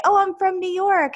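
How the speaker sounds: high-pitched quoted boast; imitating self-styled New Yorkers. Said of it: "some people would say".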